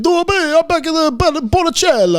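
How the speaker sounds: high, hammed-up mock Italian accent. Shown as a demonstration. Comic showing off accents that are allowed, mock Italian accent. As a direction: burst into exaggerated sing-song Italian, pitch way up. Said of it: "more of an Italian".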